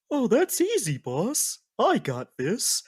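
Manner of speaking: high bear voice, easing down; confident Yogi-style sidekick; cartoon bear. Bear offers the boss help, high cartoon voice. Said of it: "you hear the bear go".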